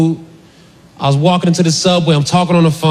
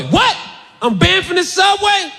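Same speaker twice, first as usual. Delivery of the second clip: high, slow disbelief; can't believe it; mock shock at the ban; incredulous; 12 semitones higher, slower than usual. Shocked at being banned.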